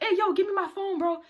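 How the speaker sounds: high-pitched mock annoyance; comically mad. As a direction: demand it back, half-joking. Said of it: "he got mad, like, in a funny way".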